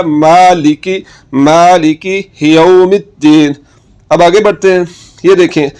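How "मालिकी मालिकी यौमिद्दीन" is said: मालिकी with a clear ki under the zer; slow careful recitation. Shown as a demonstration. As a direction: slowly stretch मालिकी यौमिद्दीन, ki not ke. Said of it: "तो क्या हो गया?". (धीमा, साफ़ तिलावत, मालिकी में की)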